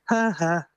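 high-pitched mimicked "ha ha" of a child; imitated child's laugh. Investigator recreating a captured child's laugh, high mock laughter. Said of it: "we get a child laughing".

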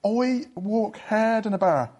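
slow, broad put-on Dublin accent. Shown as a demonstration. Guest repeating his party piece on request, slowly in a Dublin accent. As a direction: draw out a mock Dublin accent, BAR as "bear". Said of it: "gonna try a Dublin accent".

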